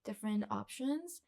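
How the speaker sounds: ordinary talk, nothing marked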